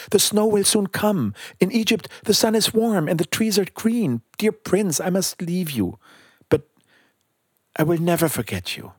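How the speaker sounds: high-pitched storybook bird voice; high and earnest, easing down towards the farewell; pleading little bird. A narrator's reading. Bird telling the prince he must leave, gently pleading.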